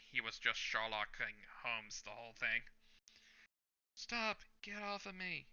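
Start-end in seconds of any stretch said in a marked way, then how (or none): 3.9-5.5 put-on high, panicked girl's cry; voiced girl shrieking at her attacker; frightened girl. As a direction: cry out like a scared girl held by thugs. Girl being grabbed by thugs.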